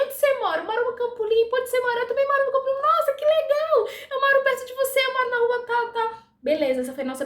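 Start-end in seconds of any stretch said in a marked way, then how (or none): 0.0-2.8 high, quick back-and-forth; replaying a friendly chat at the dentist's chair, pitched up high; lively high chatter. Patient and assistant trading where they live, bright and high. 2.8-6.3 high, louder delighted gush; thrilled to find a neighbour; delighted exclaim. Retold or imitated. Assistant tells patient she lives nearby, gushing high and loud.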